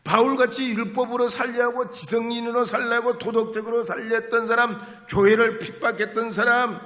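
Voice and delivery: loud, high fiery preaching; preaching at full force. Acted. Preacher telling the congregation of the wild dawn prayer, fiery preaching. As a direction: pour it out like a revival preacher.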